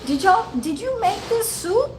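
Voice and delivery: high-pitched mock voice for a man in a wheelchair; Larry. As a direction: ask it high, imitating an amused questioner. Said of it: "he doesn't say it in my voice".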